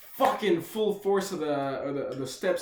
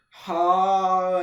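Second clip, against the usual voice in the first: acted helpless cry; 3 semitones higher, 9 dB louder than usual. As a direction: hold out a helpless stoned "haaa". Helpless at train door, acted.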